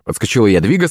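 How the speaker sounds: ordinary delivery, nothing marked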